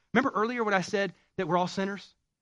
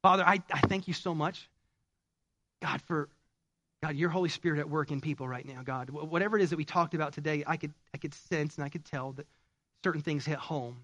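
prayer. Acted. Closing prayer.

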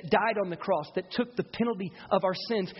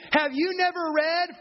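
mocking rebuke; 8 semitones higher, 4 dB louder than usual. Jesus rebuking the leaders.

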